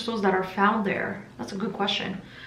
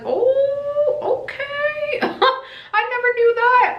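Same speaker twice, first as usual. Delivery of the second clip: high squeaky voice with a laugh in the middle; surprised realization; much slower than usual. Retold or imitated. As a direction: act out the moment you discovered it, giggling. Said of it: "I was like".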